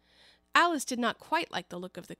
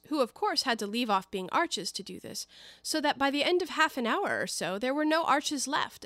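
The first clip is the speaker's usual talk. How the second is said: plain reading voice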